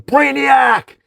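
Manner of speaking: furious-sounding yell of a name; high, loud, stretched-out challenge; Superman. Acted. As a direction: bellow the villain's name as you burst in.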